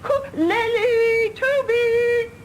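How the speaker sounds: high calls across the garden; eager high-pitched calling of names. Acted. Calling the children to come.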